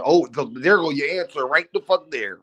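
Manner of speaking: ordinary delivery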